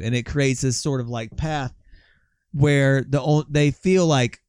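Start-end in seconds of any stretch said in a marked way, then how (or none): none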